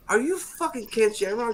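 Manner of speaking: high-pitched starstruck disbelief; retold amazement. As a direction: act out suddenly recognizing someone famous. Acting his startled recognition.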